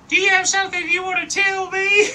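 very high, loud mock interrogation; accusing shout. Someone confronting a tripping teenager outside, shouting high and accusing. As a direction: imitate a shrieked, demanding confrontation.

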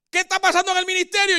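lightly voiced wife asking about church. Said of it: "Porque ella me dice".

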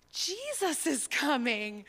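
put-on slow, high, wide-eyed little girl voice; lightly voiced excited toddler; imitating a toddler. Niece repeats to Papa, slow excited little girl voice. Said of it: "She's like".

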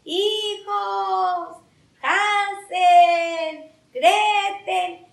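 high, drawn-out singsong call; distant calling voice. Acted. Papá looking for his lost children, calling from afar. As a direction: call out from far off, holding each name. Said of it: "a lo lejos se oye una voz".

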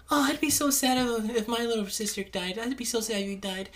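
high, soft whiny sympathy; mock sad cooing. Moviegoers chatting over Georgie's funeral, high mock-sad cooing. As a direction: whimper in hammed-up sorrow.

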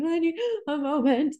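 high-pitched mock weepy squeak; imitated tearful choking. Bride imitating herself mid-vows, too tearful to talk. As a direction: beg for a pause through pretend tears. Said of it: "I couldn't even talk".

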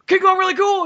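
high, loud imitated stranger; retold shout. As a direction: fire it back as a mock-yelled question. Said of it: "like, just shouting from".

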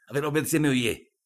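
loud, high barked command; angry order. Acted. Stepfather yelling at the girl to obey him. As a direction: force her to obey.

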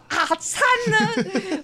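a laughing cry of oh no; dismayed cry. Retold or imitated. Daughter told to kiss her mother on camera, slow laughing dismay.

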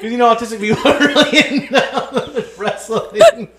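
high-pitched talk through laughter; laughing. Real, not acted. Host explaining the brother's nickname, laughing helplessly. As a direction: crack up while explaining. Cracking up at own joke.

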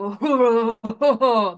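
a big booming imitated guffaw; loud guffaw. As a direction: throw out a loud fake guffaw. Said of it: "loud and like a guffaw".

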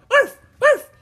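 high, loud little-dog yaps; dog bark. A narrator's reading. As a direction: imitate a small dog barking sharply. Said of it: "Teddy barked".

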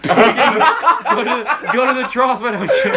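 high, loud laughing jeer; mocking taunt. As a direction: taunt them like pigs at a trough, laughing. Taunting kids from the car.

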